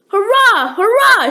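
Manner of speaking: high loud cheer; joyful shout. A narrator's reading. Jack cheers on hearing the hut is near. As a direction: cheer for sheer joy. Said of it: "shouted Jack".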